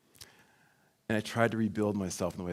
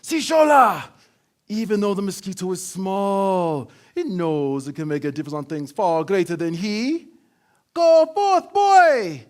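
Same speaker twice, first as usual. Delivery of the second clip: much higher, louder, proclaiming father; father rousing his son to act; grand dad voice; 8 semitones higher, 10 dB louder than usual. Retold or imitated. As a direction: declaim it like a king sending his son off. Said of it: "my dad continued".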